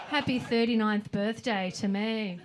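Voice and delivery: normal talking voice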